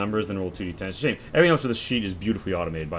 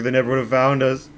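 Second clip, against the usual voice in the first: higher, louder, fast tearful rant; sobbing, rushed; 3 semitones higher, 6 dB louder, faster than usual. Acted. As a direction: pour it all out fast through sobs. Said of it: "but he's sobbing".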